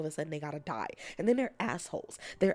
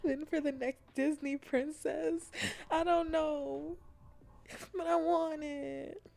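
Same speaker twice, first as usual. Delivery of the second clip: whiny wish; 7 semitones higher, slower than usual. Real, not acted.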